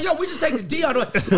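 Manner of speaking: retold loud blurt. Newcomer telling his homeboys on the platform, retold loud blurt. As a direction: re-enact blurting it out to a friend. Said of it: "You said it loud".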